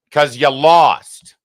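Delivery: slow, loud, drawn-out gloating; loud taunt. Real, not acted. Host throws the election loss at Hillary, loud taunt. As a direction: stretch LOST high and loud.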